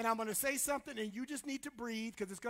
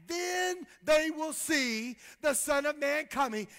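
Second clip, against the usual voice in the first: loud, building pulpit cry; rising shouted sermon; full-force preaching; 3 semitones higher, 6 dB louder than usual. Real, not acted. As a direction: preach it to the back row.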